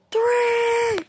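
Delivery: high shouted three; three bursts out as a high yell. Acted. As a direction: yell the last count. Countdown ends in shout.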